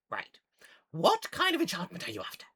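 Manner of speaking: high, loud gnome question; gnome shopkeeper. Acted. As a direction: get down to business in gnome voice. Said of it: "and she goes".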